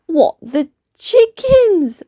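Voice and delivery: very high shocked squeal; high shocked bee chorus; bees gasp. Acted. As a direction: gasp it high like a startled swarm. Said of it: "gasped all the bees".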